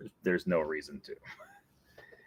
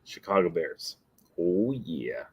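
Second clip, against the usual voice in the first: ordinary talk, nothing marked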